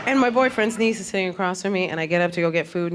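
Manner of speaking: ordinary talk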